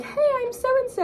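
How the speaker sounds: high, slow sing-song greeting; sweet introduction. Retold or imitated. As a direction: put on a very high polite hello. Introduces self to interviewer, retold.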